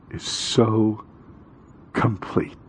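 soft whispered word; hushed emphasis. Real, not acted. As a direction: let it fall to a whisper. Preacher drops voice after climax.

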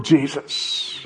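soft, breath-spent plea; hushed prayer. Shown as a demonstration. Preacher ending his cry to God, hushed. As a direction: let the name fall away quietly.

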